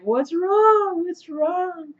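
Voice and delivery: high-pitched, drawn-out imitation of worry; mock concerned friends. Quoting friends as she cried, high mock concern. Voices everyone's alarm at her crying.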